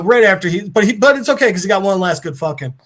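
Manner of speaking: ordinary talk, nothing marked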